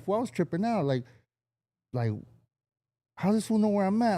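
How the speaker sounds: high, incredulous retelling; mock confusion from a friend; retold disbelief. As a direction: sound baffled and tripped out. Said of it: "He's like".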